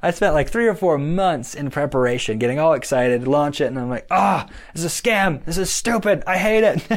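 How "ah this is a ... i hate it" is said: higher, fed-up mock rant; mock frustration. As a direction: vent like you've been scammed. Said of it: "I was so mad".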